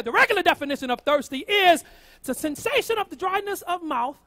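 ordinary talk, nothing marked

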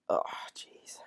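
normal talking voice

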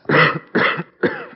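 a sudden high, hacking cough. Acted. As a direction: cough hard from a sore throat. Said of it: "something for that cough".

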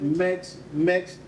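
ordinary delivery

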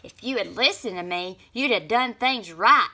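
loud shout after them; yelling. A narrator's reading. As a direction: yell at the departing friends. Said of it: "Snap Bean yelled after them".

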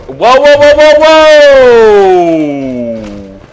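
loud, high whooping amazement; thrilled, high-pitched shouting; real excitement. Real, not acted. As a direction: yell it out, amazed at your own skill. Thrilled at own lucky play.

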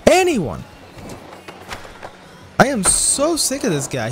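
loud, high frustrated rant. Real, not acted. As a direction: vent about the rival, loud. Ranting about rival skater.

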